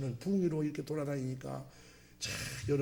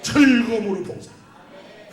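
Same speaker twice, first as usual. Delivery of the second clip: rising into a louder, higher prayer; fervent prayer; 7 semitones higher, 10 dB louder than usual. Shown as a demonstration. Pastor showing how he prays for volunteers, fervent prayer. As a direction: pray it aloud with growing fervour. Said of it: "항상 기도할 때 그렇게 해요".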